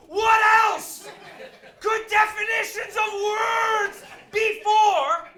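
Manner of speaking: high, exasperated full-volume shout, words drawn out; retold furious yell at a phone agent. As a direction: scream it slowly as an outraged customer. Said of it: "you're raising your voice".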